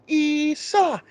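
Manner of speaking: high, drawn-out imitated chant; goofy mock battle cry. Fan mimics warriors all doing the cry, high mock yell. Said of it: "goofy that they're all doing the".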